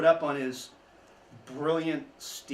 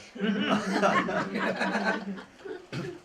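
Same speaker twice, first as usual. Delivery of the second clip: a high, rising chuckle at his own joke; amused laugh; 7 semitones higher, 4 dB louder than usual. Real, not acted. Guide laughing at his own Boston accent joke, bright chuckle. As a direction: laugh it off warmly.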